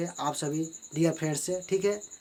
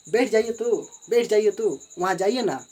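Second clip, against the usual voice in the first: higher and louder, pressing the order; emphatic command; 4 semitones higher, 6 dB louder than usual. Shown as a demonstration. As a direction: insist firmly, lean on बैठ. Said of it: "अब इसको emphatic बनाना है". (ज़ोर देकर ऊँची आवाज़ में कहना)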